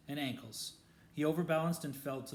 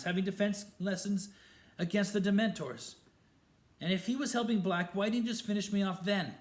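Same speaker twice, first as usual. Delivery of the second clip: higher, louder and fast; defiant shouting; 8 semitones higher, 7 dB louder than usual. A narrator's reading. Harry defending Lupin against Snape, loud and fast. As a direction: argue back hard and quick.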